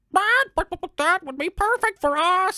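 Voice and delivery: very high, loud stuttering rooster; excited rooster. Acted. The rooster cheers the robbers' feast, high and stammering. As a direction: sputter with glee like a rooster.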